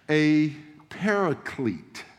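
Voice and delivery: "paraclete" drawn out slowly, every syllable clear; "paraclete" pronounced slowly and distinctly; careful Greek word. Shown as a demonstration. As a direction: spell out "paraclete" with your voice. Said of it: "That's the Greek word".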